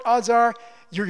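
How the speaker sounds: normal talking voice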